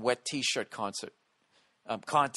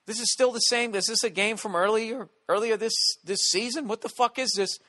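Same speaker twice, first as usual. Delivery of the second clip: higher and louder, incredulous disbelief; baffled at the replay, what just happened; retold confusion; 11 semitones higher, 6 dB louder than usual. Comic recalls watching the match replay, loud mock bewilderment. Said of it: "We're like".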